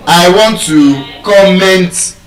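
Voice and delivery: "comment" as com-MENT; mistaken second-syllable stress. Retold or imitated. Teacher echoing a student's mistake, second-syllable stress on "comment". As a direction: lean slowly on -MENT in "comment". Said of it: "You are stressing it on the second syllable".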